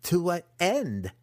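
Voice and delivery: high, slow, drawn-out taunt; teasing. A narrator's reading. Apprentice waves the crossbow at his captive, teasing. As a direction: draw the question out to tease. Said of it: "Massage teased".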